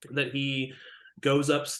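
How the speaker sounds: normal talking voice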